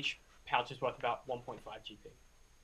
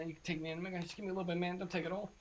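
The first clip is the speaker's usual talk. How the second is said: lightly voiced addict, softer begging; higher, softer junkie plea; pleading druggie; 4 semitones higher, 6 dB quieter, much faster than usual. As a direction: wheedle for a little bit. Game master voices the captive.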